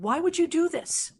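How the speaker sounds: Lauren's rising shout down the speakerphone. A narrator's reading. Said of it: "Lauren shouted".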